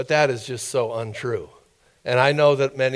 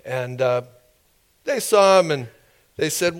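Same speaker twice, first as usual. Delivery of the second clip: ordinary talk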